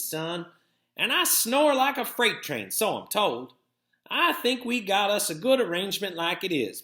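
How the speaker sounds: lightly voiced grandmother turning him down; Granny. A narrator's reading.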